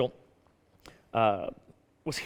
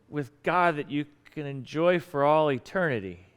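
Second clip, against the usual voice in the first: slow, drawn-out catchphrase, pitched up; quoting the pastor; 4 semitones higher, slower than usual. Retold or imitated. Man finishing his pastor's line verbatim, slow and emphatic. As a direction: stretch it out like a preacher's slogan. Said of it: "I started quoting you verbatim".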